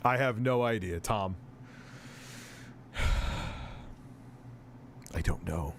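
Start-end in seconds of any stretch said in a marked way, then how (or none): 2.9-3.9 long exhaled sigh; weary sigh. Real, not acted. Streamer at a loss over a viewer's question, sighing. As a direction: let out a long breath. Audible sigh before not knowing.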